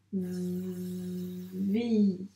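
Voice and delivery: "Vi" with a very long v, then held i; sounded-out syllable. Shown as a demonstration. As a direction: buzz the v of "Vi" slowly as if writing it. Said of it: "donc on doit le dire, les sons".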